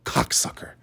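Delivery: whispered, relishing the word; hushed savouring. Shown as a demonstration. Podcaster relishing a favorite swear word, whispered.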